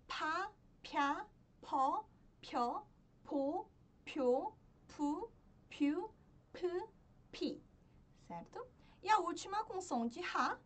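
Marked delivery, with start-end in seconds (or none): 0.1-7.6 "Pa, pya, po" evenly spaced, each lifting at the end; syllable drill. Shown as a demonstration. Teacher reciting the penultimate consonant's row, syllable drill. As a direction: model each syllable separately with a rising tune.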